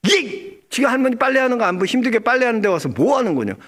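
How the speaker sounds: high, fast mock scolding as the grandmother; scolding grandma. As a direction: rattle off the grandma's telling-off, pitch up. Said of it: "나한테 막 야단치는 거야".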